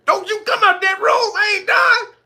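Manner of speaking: high shouted order; hammed-up command from the bed; mock angry yell. Man voice yelling again.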